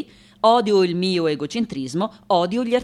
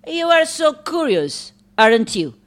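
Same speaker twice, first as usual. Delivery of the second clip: sarcastic scolding; 7 semitones higher, 5 dB louder than usual. Acted. Scolding nosy visitors.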